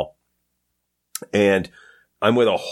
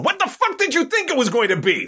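high exasperated retort, much higher; imagined retort. Retold or imitated. Comic telling off the coat guys, high and exasperated. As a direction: snap back at the older guys, pitched way up. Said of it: "turning around and going".

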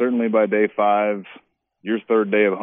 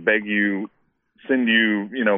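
usual speaking voice

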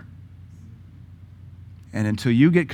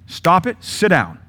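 sharp, higher, louder "stop it"; stern parent command; 6 semitones higher, 4 dB louder, slower than usual. Retold or imitated. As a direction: scold the kid to sit down. Parent voiced scolding child.

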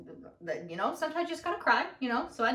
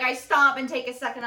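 usual speaking voice

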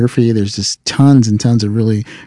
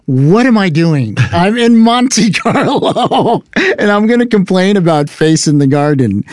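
laughing self-mockery; 7 semitones higher than usual.

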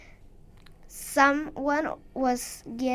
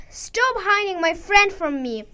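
higher, pushing demand; angry scream; 6 semitones higher, 4 dB louder than usual. Acted. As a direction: shout to get her friend back. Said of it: "screamed Wirla".

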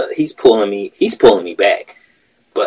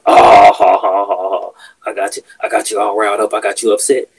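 louder taunting fake laugh, then gloating; mocking laugh; 6 dB louder than usual. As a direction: gloat at the one you riled up. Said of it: "turns around and says".

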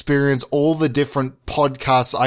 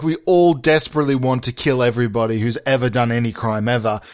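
ordinary talk, nothing marked

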